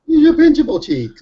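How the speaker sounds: put-on high loud squealing baby talk; squealing baby talk aunt. Cooing about son's cheeks.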